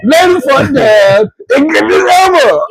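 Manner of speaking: high, hammed-up yelling; mock shout. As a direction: yell it in mock indignation. Same joking shouted act continues.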